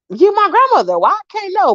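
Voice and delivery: very high-pitched retold retort; indignant protest. Granddaughter demanding to know where grandma went, indignant. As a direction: argue back in high disbelief.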